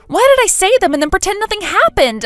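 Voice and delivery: high, loud exasperated wail; self-reproach. Acted. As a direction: burst out in frustration at yourself. Berating herself for her cowardice.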